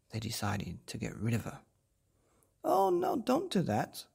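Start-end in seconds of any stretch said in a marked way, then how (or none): 2.6-4.1 high, loud plea; robber girl crying out. A narrator's reading. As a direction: cry out to stop them. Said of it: "cried the robber's daughter".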